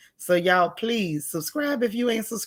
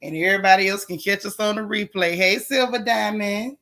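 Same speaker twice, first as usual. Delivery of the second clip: ordinary talk, nothing marked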